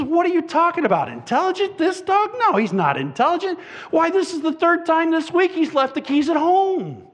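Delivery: high-pitched, incredulous retort; indignant dog owner. Acted. Angry owner answering the butcher about his dog, indignant high voice.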